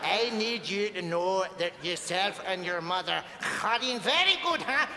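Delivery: Northern Irish sliding into mock Mexican. Medium adding Mexico to dead dad's voice, mixed Northern Irish-Mexican accent. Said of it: "he spent a lot of time in Mexico".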